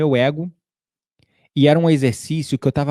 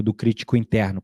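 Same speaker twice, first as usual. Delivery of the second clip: normal talking voice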